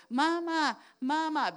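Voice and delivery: put-on high child calling mama; small child calling for mama. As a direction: call out like a little kid. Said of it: "a little child starts saying".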